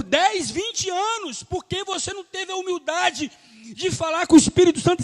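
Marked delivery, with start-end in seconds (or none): 3.7-5.0 loud, high preaching burst; preaching shout. Real, not acted. Pastor to the congregation, shouting the point. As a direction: hammer the words out to the church.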